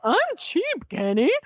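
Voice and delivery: very high, squeaked mock indignation; squeaky impression, flipping up at the end; breaking adolescent voice. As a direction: imitate an offended teen with a cracking voice. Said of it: "his voice is, like, breaking like he's fourteen".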